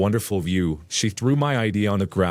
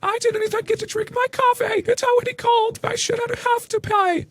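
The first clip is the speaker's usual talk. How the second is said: hammed-up high-pitched furious mother; screaming Karen. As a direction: shriek it up high like an outraged mother. Narrator voices Karen.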